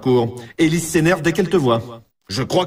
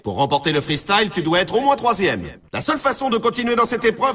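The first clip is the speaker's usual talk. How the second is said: upbeat, high-pitched sports announcer; game announcer; 7 semitones higher than usual. Acted. Announcer briefing a player on what it takes to win, high and excited. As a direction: pitch rising to excitement on the key phrases.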